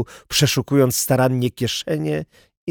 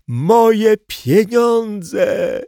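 high, long-drawn wail; slow, high cry of dismay; robbed man's cry; 10 semitones higher, 4 dB louder, much slower than usual. A narrator's reading. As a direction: stretch it out in a raised wailing call. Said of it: "wołał okradziony".

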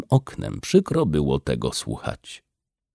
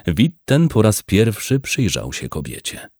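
usual speaking voice

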